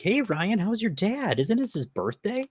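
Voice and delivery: high, bright friendly greeting; cheerful passerby. Retold or imitated. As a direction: voice the old acquaintance. Dad's old gymnast greets him.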